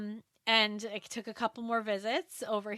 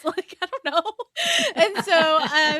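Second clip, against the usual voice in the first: laughing; 7 semitones higher, 9 dB louder, slower than usual. Real, not acted. Laughing about not knowing accent.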